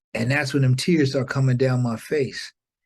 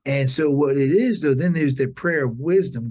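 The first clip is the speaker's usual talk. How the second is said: usual speaking voice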